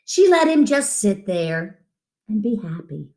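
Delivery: usual speaking voice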